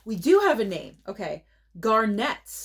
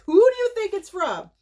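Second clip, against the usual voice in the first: excited; 11 semitones higher, 6 dB louder than usual. Real, not acted. Excited quiz for viewers.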